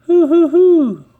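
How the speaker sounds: high, loud bouncy hoo-hoo-hoo; Tigger's hoot. Acted. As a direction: whoop it out like a bouncing tiger. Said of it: "Tigger always says".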